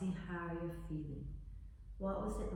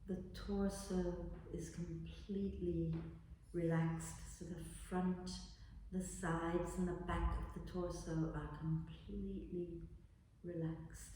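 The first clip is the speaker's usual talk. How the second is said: guided meditation; 8 dB quieter than usual. Acted. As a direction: guide them gently into relaxing. Leads a body relaxation.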